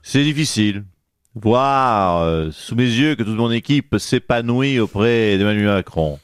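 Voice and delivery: low, put-on politician voice, hammed-up heartbreak; low mock-wounded lament; mock jilted politician. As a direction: play the betrayed boss in a low caricature voice.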